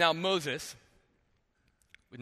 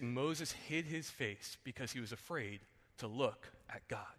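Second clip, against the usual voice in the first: hushed, reverent Bible reading, softer as it goes; scripture reading; 4 semitones lower, 4 dB quieter than usual. Acted. Pastor reading Exodus to the congregation, hushed and reverent.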